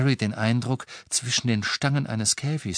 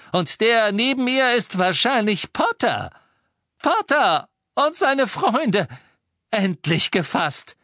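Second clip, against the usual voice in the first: high, loud, drawn-out triumph; triumphant shout; 13 semitones higher, 5 dB louder than usual. A narrator's reading. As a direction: announce the catch at full excitement. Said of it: "rief Lucius". (triumphierend rufend, laut und hoch)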